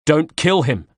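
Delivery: loud roar to stop the shot. Acted. Narrator roars at archer not to kill. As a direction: bellow it out to stop him. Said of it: "I roared".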